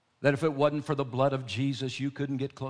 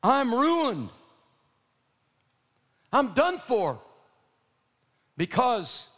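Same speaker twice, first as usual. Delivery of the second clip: anguished cry; 12 semitones higher, 7 dB louder, slower than usual. Acted. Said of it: "I cried".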